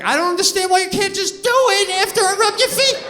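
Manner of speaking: loud, high protest that climbs and holds; exasperated retort. Retold or imitated. Husband arguing with wife over foot rubs, loud exasperation. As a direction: whine back at full volume in a mock fight. Acted argument with his wife.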